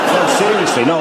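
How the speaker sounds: ordinary talk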